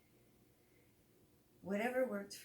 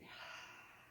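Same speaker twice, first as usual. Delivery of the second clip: long breathy release sigh; audible sigh; 10 dB quieter than usual. Shown as a demonstration. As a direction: let the breath fall out with a sigh. Teacher models a relaxing exhale.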